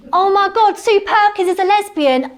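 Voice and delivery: high, loud revelation; a giddy, shocked discovery; excited. Real, not acted. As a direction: blurt it out, thrilled. Thrilled revelation.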